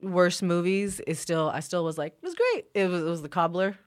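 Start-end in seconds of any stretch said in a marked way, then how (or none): 2.1-2.7 high-pitched mock approval; indulgent fan. Devoted fan defends the worst movie, indulgent. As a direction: lift it high, excusing the flop.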